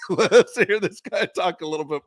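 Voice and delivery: high laugh bubbling through the words. Real, not acted. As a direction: laugh your way through the line. Laughing about the Southern coach.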